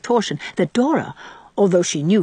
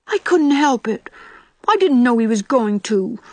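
put-on higher, flustered little girl's cry; embarrassed cry; 5 semitones higher, 3 dB louder than usual. A narrator's reading. Girl threatened with a secret kiss, flustered high cry. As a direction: blurt it out, ashamed and flustered. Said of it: "cried Dora, blushing scarlet".

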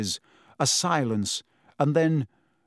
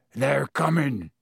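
Hagrid, dread-struck. Acted. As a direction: say it slowly, frozen with fear.

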